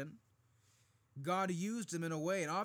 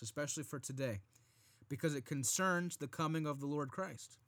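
normal talking voice

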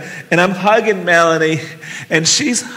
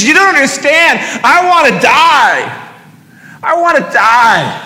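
anguished cry; 9 semitones higher, 5 dB louder than usual. Retold or imitated. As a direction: cry out in despair. Distraught woman crying out in retold scene.

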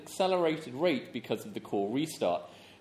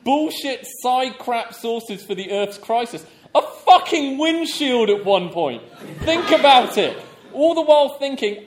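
loud, mocking disbelief; incredulous outrage; 7 semitones higher, 8 dB louder than usual. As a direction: rant it out, laughing at the absurdity. Reading the writer's angry letter.

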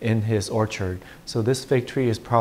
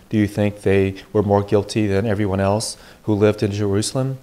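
louder, formal proclamation from the pulpit; Gospel reading; 4 dB louder than usual. Acted. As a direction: read scripture to the congregation.

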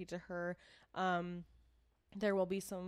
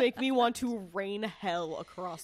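loud, growling demon voice; 4 semitones higher, 8 dB louder than usual. Acted. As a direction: roar it like a furious demon. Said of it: "Demon voice".

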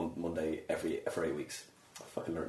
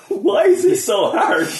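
high, loud, slow comic plea; laughing mock despair; 10 dB louder, slower than usual. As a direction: act out an exasperated MC while laughing. Voices struggling MCs, laughing.

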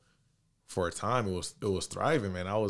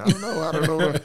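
high, loud panicked dithering; hammed-up panic with laughter in it; mock panic; 9 semitones higher, 11 dB louder than usual. Acts out panicked indecision, laughing.

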